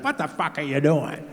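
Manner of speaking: dad's mock outrage, pitch climbing; snapping dad. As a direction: imitate a father losing it, voice rising. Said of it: "My dad snapped".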